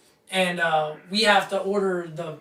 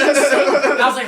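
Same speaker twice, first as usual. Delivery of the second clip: laughing; 4 semitones higher, 8 dB louder, much slower than usual. Real, not acted. Laughing while retelling.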